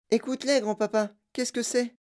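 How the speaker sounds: put-on high-pitched eager child voice; wondering child. A narrator's reading. Charlie asks grandfather about chanting, wondering.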